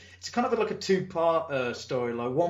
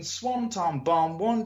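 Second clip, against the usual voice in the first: ordinary talk, nothing marked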